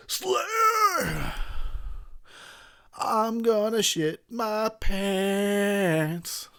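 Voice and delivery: high drawn-out metalhead cry; hyped. Acted. Drunk metal fan crying out for Slayer, hyped. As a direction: wail it high, then drag the words out slowly. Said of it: "I get hyped".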